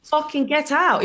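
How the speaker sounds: high, loud snap; mock furious order. Comic ordering her mate out of the gig, high and loud. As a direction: kick him out. Throwing heckler out.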